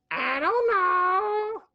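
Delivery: very high, drawn-out silly reply; high sing-out joke answer; comic high voice. Pretend. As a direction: stretch it out in a squeaky-high put-on voice. Comic answer to sign-off question.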